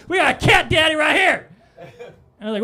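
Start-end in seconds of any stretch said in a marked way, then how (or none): 0.1-1.5 high, loud shouted announcement; imitated guard shout. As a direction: bellow it across the line like a guard. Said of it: "And he goes".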